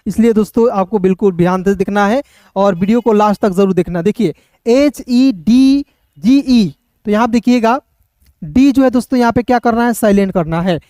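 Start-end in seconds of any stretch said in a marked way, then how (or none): 4.7-6.7 slow, stretched letter names; slow spelling. Shown as a demonstration. Teacher spelling a new word, slowly letter by letter. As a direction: dictate each letter slowly, drawing it out.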